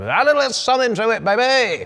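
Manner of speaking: high, loud showy host voice; hyped intro voice. Retold or imitated. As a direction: ham up the camera intro. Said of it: "that's a bit more fun".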